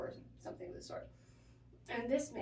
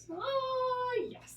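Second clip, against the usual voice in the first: put-on high, loud, slow elderly-man voice; aphasia patient; 14 semitones higher, 3 dB louder than usual. Nurse retells patient ordering breakfast, high slow mock old man. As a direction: draw it out sweetly, much higher and louder. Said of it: "It was so cute".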